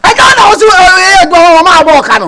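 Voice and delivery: high, fast proclamation. Shown as a demonstration. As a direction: pitch it up and rattle the line out.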